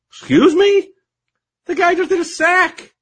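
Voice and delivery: high, loud outraged "excuse me"; indignant disbelief. Acted. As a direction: pretend shock at a silly rule. Indignant retort to the rule.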